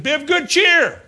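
high and loud over the storm; loud call. Acted. Paul calling to the men on the boat, loud and high. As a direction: call out to the whole crew.

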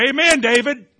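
loud, high cheer of support; cheering shout. Acted. People on the hillside cheering David, loud high cheer. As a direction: shout your approval from the hillside.

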